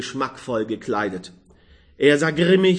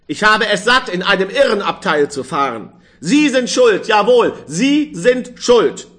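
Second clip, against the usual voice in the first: loud, higher, fast indignant tirade; angry rant; 6 semitones higher, 5 dB louder than usual. Acted. Old man berating the young man, loud and furious. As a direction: spit out the accusation again and again. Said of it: "sagte er zornig".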